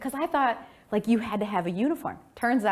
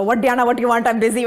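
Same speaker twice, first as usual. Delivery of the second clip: louder, brusque dad voice; impatient father; 4 semitones higher, 10 dB louder, faster than usual. Retold or imitated. Father on the collect call, impatient and busy. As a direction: imitate a busy dad who is interrupted.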